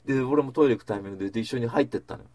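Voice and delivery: ordinary talk, nothing marked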